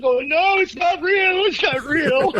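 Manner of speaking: high, breathless plea through laughter; laughing while pleading. Retold or imitated. Fleeing his furious bandmate, pleading through helpless laughter. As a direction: try to calm him but can't stop laughing. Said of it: "I couldn't tell him 'cause I was laughing too hard".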